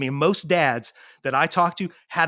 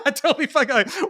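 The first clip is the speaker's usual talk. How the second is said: much higher, laughing mock repeat of the tough-guy line; mocking echo; 11 semitones higher than usual. Mocking the dads' boast from anger management group, high and laughing. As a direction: tease the brag back in a high voice.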